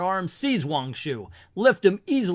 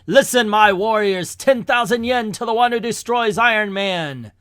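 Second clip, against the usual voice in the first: high, booming proclamation; rally the troops at full voice; loud summons; 5 semitones higher, 6 dB louder than usual. Acted. Warlord summons his men over a loudspeaker, shouting. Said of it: "use the loudspeaker to summon his men".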